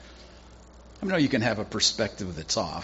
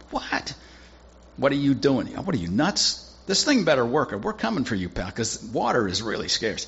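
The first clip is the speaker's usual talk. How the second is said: exasperated protest; 3 semitones higher, 3 dB louder than usual. Acted. Servants protest again.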